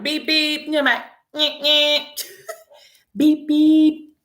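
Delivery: imitated car horn. Car horn outside the house.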